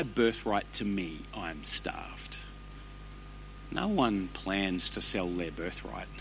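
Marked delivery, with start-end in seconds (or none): none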